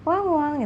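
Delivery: high, playful woof-woof; dog bark imitation. Shown as a demonstration. Teacher imitates a dog for students, playful high bark. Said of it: "Suaranya gimana?".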